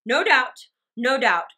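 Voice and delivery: loud, emphatic husband; brusque concession. A narrator's reading. Husband concedes to his wife, loud and brusque. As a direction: toss it back forcefully, twice over.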